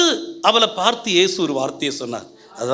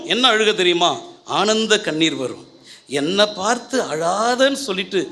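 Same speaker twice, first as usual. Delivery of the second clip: ordinary talk, nothing marked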